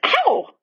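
sudden high yelp like a wasp sting; sharp yelp of pain. Acted. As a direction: yelp out a startled ow. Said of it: "made a noise like".

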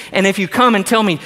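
ordinary talk, nothing marked

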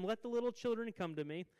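a preacher quoting Jesus out loud. Retold or imitated. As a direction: proclaim it boldly to the crowd. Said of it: "He said to them".

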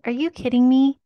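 hammed-up outrage at one bathroom; mock exasperated complaint. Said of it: "And you're like".

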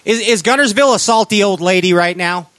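ordinary talk, nothing marked

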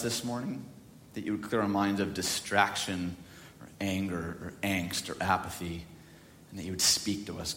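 low, soft praying voice; prayer. Real, not acted. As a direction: pray quietly and reverently to God. Leads an opening prayer.

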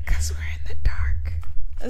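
slow hushed whisper. Real, not acted. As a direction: whisper it slowly into the dark. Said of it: "'cause you're fucking whispering".

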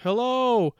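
high, drawn-out holler, held back in volume; mock yell. Alone in the woods yelling out, high mock yell. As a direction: pretend to call out into empty woods. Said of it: "you yell out".

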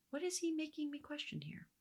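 hushed, doubting whisper to herself. Acted. Teacher voicing Eve's private doubt, in a whisper. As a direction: breathe the question like a secret suspicion.

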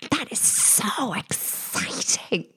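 breathless mock-awed whisper; excited whisper. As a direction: whisper it like a star-struck fan. Host gushing over trillionaire guest.

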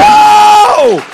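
loud, high "oh!" of triumph; loud joyful whoop; joyful shout. Retold or imitated. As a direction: shout it out with sudden joy. Joy at getting the venue.

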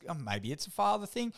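normal talking voice

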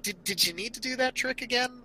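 high, fast exasperated question; incredulous. Retold or imitated. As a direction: ask it in disbelief. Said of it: "I'm just kinda watching it like".

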